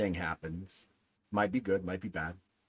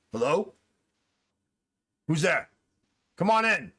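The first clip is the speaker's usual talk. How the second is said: higher, louder call toward the door; 8 semitones higher, 6 dB louder than usual. Acted. Man at a desk calls to whoever opened his door, calling out. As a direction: call out, rising in pitch on the invitation.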